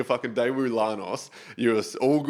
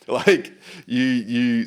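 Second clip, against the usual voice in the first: laughing through the word; 6 dB louder, much slower than usual. Real, not acted. Mate laughing while explaining hangovers. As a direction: breaks into a laugh on it. Laughing mid-sentence about drinking.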